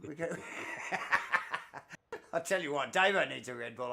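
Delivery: usual speaking voice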